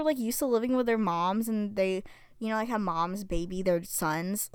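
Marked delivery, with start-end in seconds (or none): none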